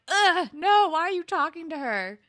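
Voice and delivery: high, loud disgusted protest; groan, then protest loud and high; acted outrage. Viewer objects to Dean greeting her, acted outrage.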